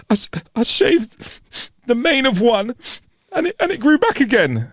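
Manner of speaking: high, broken, halting sobs; sobbing. Acted. Sobbing man pours out his problem to the bartender, high and broken. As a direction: blubber it out, stammering and slow.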